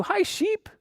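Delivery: very high-pitched little hello; cutesy greeting. Pretend. Storyteller greeting the felt dog, cutesy greeting. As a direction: greet a toy in a tiny high voice. Greeting a toy animal for children.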